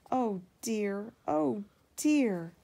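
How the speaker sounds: crying. A narrator's reading. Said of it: "James began to cry".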